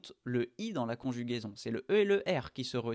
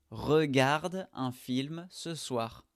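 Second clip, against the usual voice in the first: slow dictation; slower than usual. Shown as a demonstration. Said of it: "Il faut parler à voix haute".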